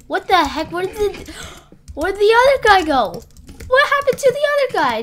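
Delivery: normal talking voice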